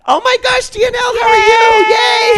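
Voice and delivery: real high-pitched, gushing excitement; excited squeal. Real, not acted. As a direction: voice leaps an octave, loud and thrilled. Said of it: "my voice goes up an entire octave".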